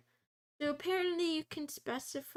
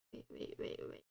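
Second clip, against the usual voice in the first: breathy, hushed wait, wait; whispered wait; 9 dB quieter, faster than usual. Real, not acted. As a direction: whisper it to yourself. Sudden self-interruption.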